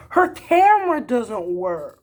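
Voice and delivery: slow, high, loud sarcastic drawl; sarcastic imitation of her excuse; mocking excuse. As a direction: stretch the lame excuse out to mock it.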